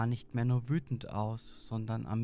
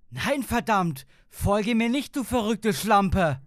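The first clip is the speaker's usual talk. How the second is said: loud, high outburst of cursing; furious shout; 10 semitones higher, 11 dB louder than usual. A narrator's reading. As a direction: yell it full force, high and loud. Husband yells at his monstrous wife.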